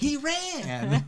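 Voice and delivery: squeaky, high-pitched imitation; high-pitched squeak. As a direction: jump way up in pitch for it. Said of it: "raises his voice really high".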